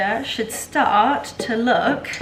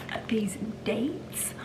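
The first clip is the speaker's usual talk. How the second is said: whisper; 9 dB quieter, slower than usual. Real, not acted. Showing dates to camera.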